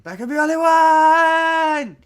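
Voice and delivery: high, loud, sustained mock scream; Lindsay Lohan. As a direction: shriek it and hold the final word. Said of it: "do it like Lindsay Lohan".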